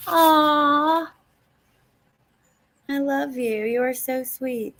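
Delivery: high, drawn-out aw softening into tenderness; high, melting gratitude; touched, near tears. Real, not acted. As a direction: gush tenderly, on the edge of crying. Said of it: "Don't make me cry".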